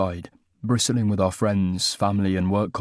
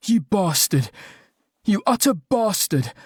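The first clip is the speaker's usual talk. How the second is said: rising, higher-pitched furious shout; furious outburst; 9 semitones higher than usual. Acted. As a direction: hurl it at him, building in fury. Upends basket over fiancé's head.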